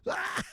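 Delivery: high mock scream of a scared rider; imitated scream. Imitating a passenger's scream on the bus. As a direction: squeal like a passenger in panic. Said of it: "you heard the screaming".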